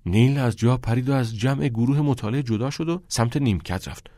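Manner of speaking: usual speaking voice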